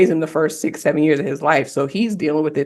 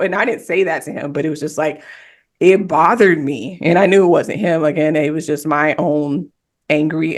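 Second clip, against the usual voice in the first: ordinary talk, nothing marked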